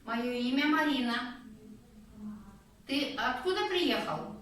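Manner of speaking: ordinary delivery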